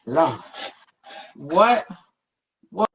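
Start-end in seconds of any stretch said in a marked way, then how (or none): none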